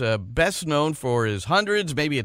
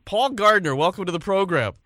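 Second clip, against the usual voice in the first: ordinary talk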